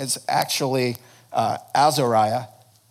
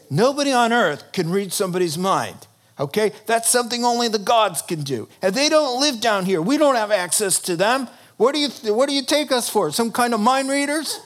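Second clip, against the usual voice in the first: indignant psychics; 7 semitones higher than usual. Acted. Psychics protesting to the king, rising indignation. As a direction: argue back, getting more worked up.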